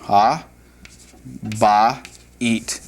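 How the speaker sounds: "Ha, ba, eat" spoken as separate beats; syllable by syllable. Shown as a demonstration. Teacher sounds out the Hebrew word for students, syllable by syllable. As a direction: break "Ha, ba, eat" into slow pieces. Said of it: "under the house".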